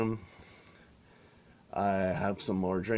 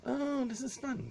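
mock kids; 4 dB quieter than usual. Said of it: "they're like".

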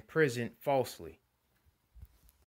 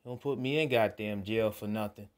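ordinary talk

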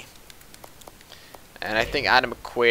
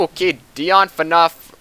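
normal talking voice

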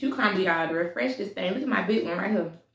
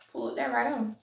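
ordinary talk, nothing marked

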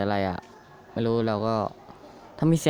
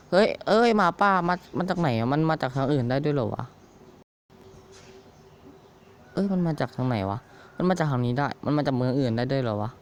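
startled outburst; 4 semitones higher than usual. Real, not acted. Wolf suddenly attacks in game.

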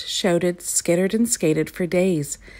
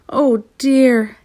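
higher, louder wail of dismay; dismayed shout; 6 semitones higher, 5 dB louder than usual. A narrator's reading. Dejected gnome crying on a log, shouting. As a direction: cry out in despair. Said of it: "he shouted".

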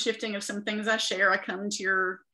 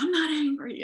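defensive denial; 8 semitones higher, 4 dB louder than usual. Retold or imitated. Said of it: "And I was like".

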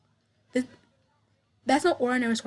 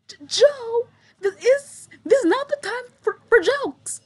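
much higher, loud, breaking with a cry; tearful pleading; 11 semitones higher, 7 dB louder than usual. Acted. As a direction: beg him to stop joking, near tears. Sarah near tears at Joe.

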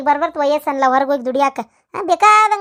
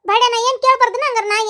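ordinary talk, nothing marked

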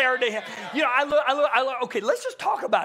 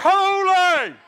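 very high, loud cry of "Holy!"; huge heavenly shout; angels crying out; 8 semitones higher, 9 dB louder than usual. Acted. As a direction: cry it out at full force, far above your usual pitch. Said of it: "one cries the other".

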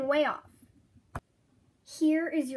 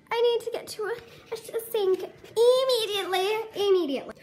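disgusted whine; 7 semitones higher, 4 dB louder than usual. Acted. Ginger covered in hot sauce.

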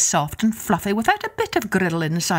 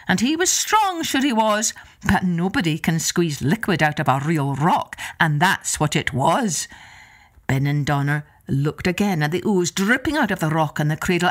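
ordinary delivery

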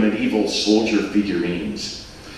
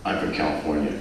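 cautious; 7 semitones lower, 8 dB quieter, faster than usual. Acted. Mike answers cautiously.

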